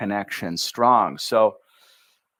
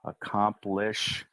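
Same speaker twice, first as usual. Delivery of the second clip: ordinary delivery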